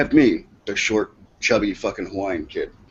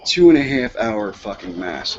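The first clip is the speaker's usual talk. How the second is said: ordinary talk, nothing marked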